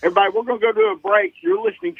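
fast, high break announcement; excited rush. Real, not acted. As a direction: hurry the call to break, bright and quick. Host rushes to break.

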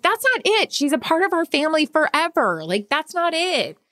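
comforting her crying husband; emphatic reassurance. Retold or imitated. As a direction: reassure him firmly, pitched high. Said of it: "I'm like".

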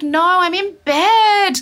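high, slow, drawn-out protest; imitated whiny refusal. She refuses to help partner, whiny protest. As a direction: refuse from bed, dragging it out. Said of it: "I was like".

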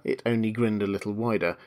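usual speaking voice

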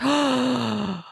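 hammed-up sharp gasp of gossip; mock shocked gasp. Abby hears juicy news, mock gasp. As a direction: gasp as if scandalized. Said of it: "she's like".

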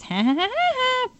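high, hammy woodpecker cackle; a feeble try at the cartoon woodpecker's laugh; Woody Woodpecker laugh. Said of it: "Was that your best Woody Woodpecker impression?".

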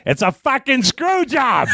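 high-pitched, drawn-out holler from the crowd; imitated outraged yell; yelled heckle. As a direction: holler it slowly so it carries over everything. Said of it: "he yells that".

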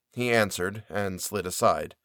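ordinary delivery

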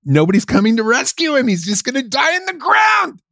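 very high-pitched exasperated shouting; outraged rant. Real, not acted. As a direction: cry out at the injustice of it. Outraged rant about film's bleak ending.

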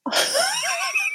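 a sudden high-pitched laugh. Real, not acted. Guest laughing at her own twelve inch joke, high laugh. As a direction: laugh high at the double meaning.

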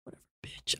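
whispered dismissive aside. Real, not acted. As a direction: breathe it out under the breath.

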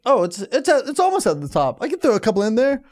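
high, casual self-justifying quote; acted-out reasoning. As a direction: reason it out aloud in a lifted voice. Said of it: "Now I'm like".

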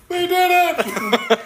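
slow, high, thrilled cheer; excited cheer. Retold or imitated. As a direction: cheer it like a lone superfan. Imitating an excited fan.

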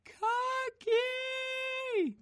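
very high shrieking cry; toddler shriek. A narrator's reading. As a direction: shriek for the lost toy. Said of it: "Matea shrieked".

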